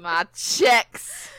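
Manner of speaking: high, loud echo; mock exclamation. Writer echoes the reader's misreading, mock exclamation.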